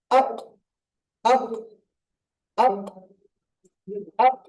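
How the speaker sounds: আক with a bouncing echo on the final ক; আক with the stop released hard; qalqala demo. Shown as a demonstration. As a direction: clip আক and let the ক bounce out. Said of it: "ধাক্কা লেগে উচ্চারণ".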